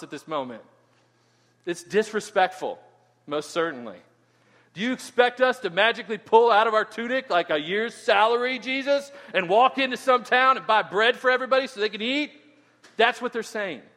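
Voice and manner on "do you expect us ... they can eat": loud, high mock sarcasm at Jesus; sarcastic disciples. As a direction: scoff at an impossible demand. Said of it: "That's how they're saying it to Jesus".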